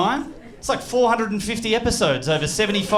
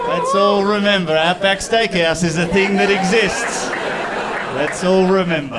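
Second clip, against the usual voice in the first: mock sincere plea; slower than usual. Mock earnest plea for unity.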